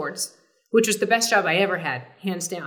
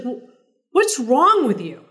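John's tone; 5 semitones higher, 6 dB louder than usual. Retold or imitated. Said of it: "you can hear that tone of John saying".

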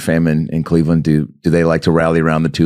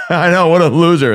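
mock agreement; 8 semitones higher than usual. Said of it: "I was like".

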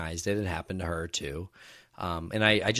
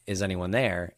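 ordinary talk

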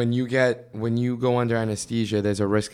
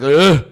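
loud mimicked gagging noise; gag; 4 semitones higher, 10 dB louder than usual. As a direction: retch as if poked in the throat. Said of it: "I, I gag".

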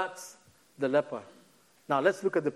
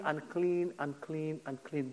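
fading leper cry; 2 semitones lower, 7 dB quieter, slower than usual. Retold or imitated. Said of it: "my way of screaming".

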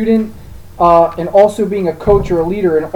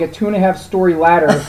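ordinary talk

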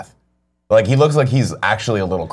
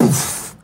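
soft airy blast noise; quiet breath-burst for a blast; imitated explosion poof; 7 dB quieter than usual. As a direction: puff out a small mock explosion.

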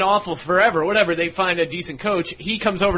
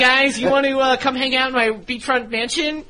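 eager nerd voice; 7 semitones higher than usual. Retold or imitated.

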